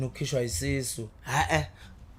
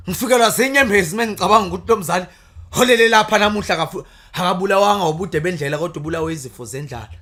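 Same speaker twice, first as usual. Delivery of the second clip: loud declaimed praise poetry; poem recitation; 5 semitones higher, 11 dB louder than usual. Acted.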